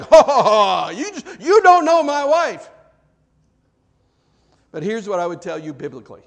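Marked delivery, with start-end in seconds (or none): 0.0-0.9 loud imitated roar of a furious wife; mock menacing roar. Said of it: "she was like". 0.9-2.7 high, very loud comic wail; protesting husband. Acted. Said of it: "you're saying".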